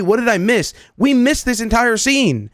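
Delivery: normal talking voice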